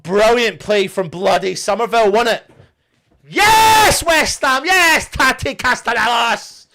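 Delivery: loud, high yes after yes; elated shouting; jubilant. Real, not acted. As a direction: celebrate like the winner just went in. Celebrating the goal live.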